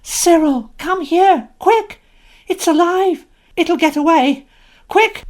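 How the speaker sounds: high, loud urgent calls; scream. Acted. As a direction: shriek for her brother to hurry. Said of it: "Anthea suddenly screamed".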